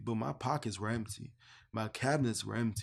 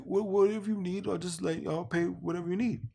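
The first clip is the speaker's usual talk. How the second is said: much higher, offhand promise; generous stranger; 7 semitones higher, 3 dB louder than usual. Retold or imitated. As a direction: wave it off, anything you need. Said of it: "He's like".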